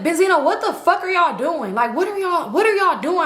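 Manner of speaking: high, indignant retold demand; retold confrontation. Confronting a friend about the girl, indignant retelling.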